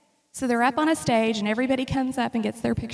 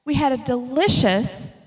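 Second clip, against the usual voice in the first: ordinary talk, nothing marked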